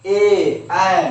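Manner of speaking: "ए" and "ऐ" called out loudly again; repeat vowel demo. Shown as a demonstration. Teacher repeating vowels for students, loud and clear. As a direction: sound "ए, ऐ" out loud for the class to copy. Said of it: "फिर से बोले".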